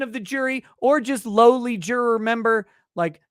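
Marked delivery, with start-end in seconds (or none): none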